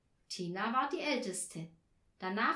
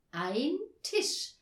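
higher, louder corrected "Ein Tisch"; eager correction; 6 semitones higher, 5 dB louder than usual. A narrator's reading. Girls correcting their pupil's article, eager. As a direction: say "Ein Tisch" keenly, each word distinct. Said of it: "verbesserten Anja und Tina eifrig".

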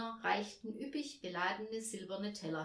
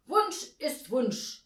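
slow, loud, higher insistence; stubborn; 6 semitones higher, 9 dB louder, slower than usual. A narrator's reading. Guest refusing to give way to the angel, stubborn. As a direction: plant each word obstinately. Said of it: "sagte der Gast störrisch".

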